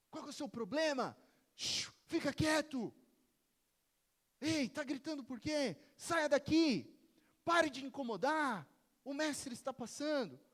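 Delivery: loud, high scolding of a beggar; angry crowd. Acted. Crowd silencing the blind beggar, angry scolding. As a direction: shush him and chase him off. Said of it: "o que que esse mendigo provavelmente ouviu".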